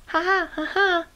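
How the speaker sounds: thin put-on cackle; gloating high laugh; hyena's laugh. A narrator's reading. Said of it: "the hyena laughed".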